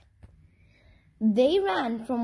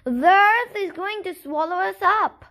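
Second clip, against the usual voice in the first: louder, higher call to the crowd; 5 semitones higher, 5 dB louder than usual. A narrator's reading. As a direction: call it out again, more insistently. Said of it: "The chicken called out again".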